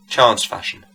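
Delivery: plain reading voice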